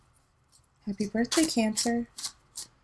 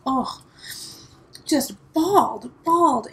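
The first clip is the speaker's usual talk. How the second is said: higher and louder on the second bawled; bawled with feeling; 4 semitones higher, 4 dB louder than usual. Real, not acted. Telling viewers how hard she cried, rising emphatically. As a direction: let the word swell with emotion. Recalling crying at the show.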